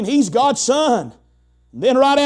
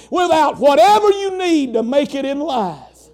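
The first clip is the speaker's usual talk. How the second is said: preaching at full force; 4 semitones higher than usual. Acted. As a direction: lift the church with a rising shout.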